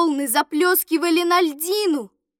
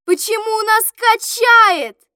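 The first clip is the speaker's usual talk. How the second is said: higher, louder startled question; raised, high-pitched question; boy's alarmed cry; 5 semitones higher, 6 dB louder than usual. A narrator's reading. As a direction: cry it out as a worried boy. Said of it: "закричал Володя".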